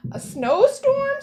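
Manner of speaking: high, slow, loud squawk of surprise; a gull shrieking the word back in disbelief. A narrator's reading. Gull mocks Quentin's forecast, high loud squawk. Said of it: "squawked the gull".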